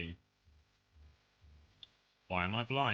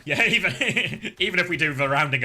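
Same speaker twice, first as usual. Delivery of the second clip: laughing; 5 semitones higher, 6 dB louder than usual. Real, not acted. Laughing at the low healing numbers.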